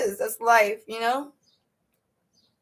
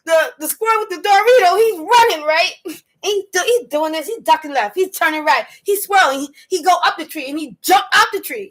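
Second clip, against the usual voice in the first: higher, louder, fast excited play-by-play of the chase; gleeful squirrel chase commentary; excited retelling; 5 semitones higher, 3 dB louder than usual. Real, not acted. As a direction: rattle it off, thrilled by the chase.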